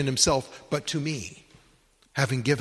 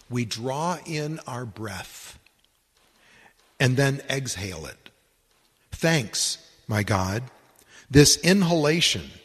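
loud, measured reading of a devotional prayer; solemn read prayer, each clause weighed; 6 dB louder than usual. Acted. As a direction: pray it out with full, deliberate emphasis. Reads a prayer aloud.

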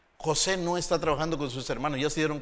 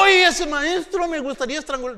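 mock fury; 12 semitones higher, 10 dB louder than usual. Quoted angry student.

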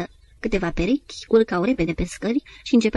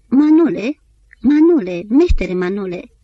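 reciting; 2 semitones higher, 8 dB louder, slower than usual. A narrator's reading. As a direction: recite it like a ballad refrain. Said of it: "apoi începu să recite".

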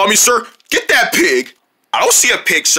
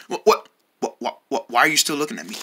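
quiet low voice; 12 semitones lower, 9 dB quieter, faster than usual. Acted. Hushed low questioning.

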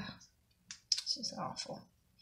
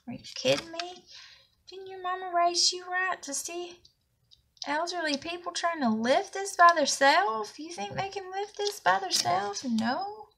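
high-pitched, indignant questions; irritated scolding; 8 semitones higher than usual. Real, not acted. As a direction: scold the absent neighbours, pitch raised in disbelief. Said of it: "Oh, it made me irritated".

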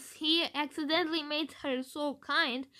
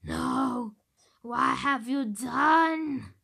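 louder, slow outraged villain; dying villain rage; 6 dB louder, much slower than usual. Retold or imitated. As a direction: roar it out as he falls. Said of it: "he might say".

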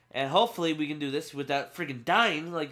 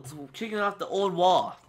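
usual speaking voice

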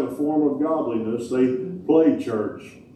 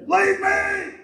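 high, cold banishing cry; the king sends the goats away with a high dismissal; king's dismissal. Acted. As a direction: cast them out as the king. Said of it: "the king will say".

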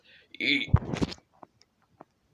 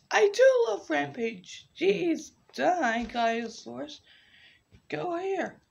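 put-on squeaky kid voice; 10 semitones higher than usual. High kid dinosaur voice.

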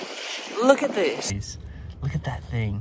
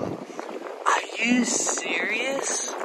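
awed; 4 semitones higher, 6 dB quieter, much slower than usual. Real, not acted. Stunned by the haul.

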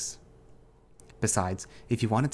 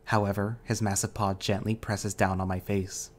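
plain reading voice

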